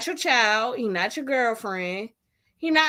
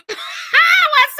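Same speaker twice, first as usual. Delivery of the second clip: laughing shout; 8 dB louder than usual. Real, not acted.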